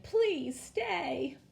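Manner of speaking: high, pleading little plea; a high pleading spider voice; begging. A narrator's reading. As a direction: beg them to stay, voice lifted high. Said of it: "Miss Spider begged".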